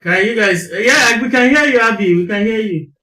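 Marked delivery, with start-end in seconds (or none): none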